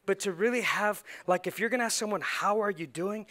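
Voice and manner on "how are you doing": slow, sincere check-in; unhurried, earnest concern; genuinely caring question. Shown as a demonstration. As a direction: ask it slowly, ready to hear the answer. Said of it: "you better make the time to hear the response".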